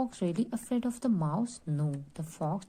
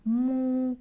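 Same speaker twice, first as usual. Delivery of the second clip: long, high moo; cow's moo; 6 semitones higher than usual. Shown as a demonstration. Teacher giving a cow riddle to pupils, imitated mooing. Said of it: "one tail and goes".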